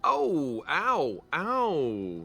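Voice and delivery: high playful ow ow; hammed-up wounded cries; mock pain. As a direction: yelp in pretend hurt. Mock hurt after teasing.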